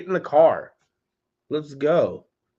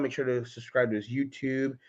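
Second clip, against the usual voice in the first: normal talking voice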